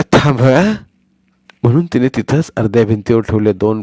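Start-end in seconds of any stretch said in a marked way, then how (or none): 0.1-0.7 put-on higher, louder old woman calling the child back; a warm summons; calling grandmother. Grandmother calling Sonya back to her, affectionate.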